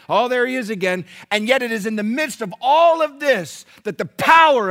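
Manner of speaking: high, driving pulpit voice; impassioned preaching. Real, not acted. Preacher expounding the miracle at the gate to congregation, impassioned.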